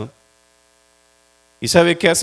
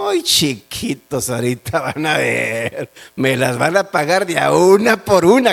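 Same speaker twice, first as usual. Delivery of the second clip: silky menacing villain; 3 semitones lower than usual. Pretend. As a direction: purr a sly promise of revenge. Joseph imagined plotting revenge.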